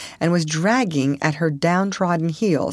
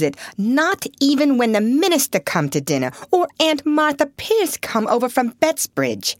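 bitter outburst; 6 semitones higher than usual. A narrator's reading.

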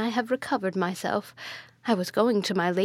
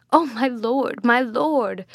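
higher, louder cry of alarm; terrified; 3 semitones higher, 5 dB louder, slower than usual. A narrator's reading. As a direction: cry out to your lord in terror. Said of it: "spoke the utmost terror".

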